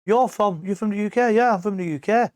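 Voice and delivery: high, bright mock-surprised locals; imitating the locals. Locals asking the English visitor where he's from, high and mock-surprised. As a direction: play the curious shop staff. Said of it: "everyone's like".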